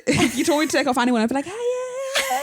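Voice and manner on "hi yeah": higher, light mock squeak; a playful imitation of the friend's greeting in a high voice; squeaky friend voice. Mocking her friend's greeting in a squeaky voice. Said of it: "that's what you sound like".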